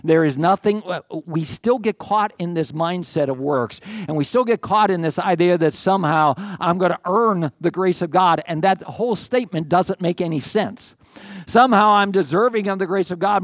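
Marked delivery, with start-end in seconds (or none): none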